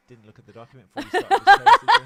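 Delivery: loud, high mocking gloat. Defendant gloating in the dock at lawyer, loud. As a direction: crow over the lawyer from the dock. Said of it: "gloating in the dock going".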